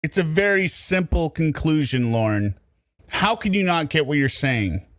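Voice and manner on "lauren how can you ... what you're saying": normal talking voice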